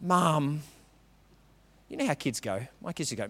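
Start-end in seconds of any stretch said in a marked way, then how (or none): 0.0-0.6 exasperated Mum. Retold or imitated. Exasperated kid, eye-roll.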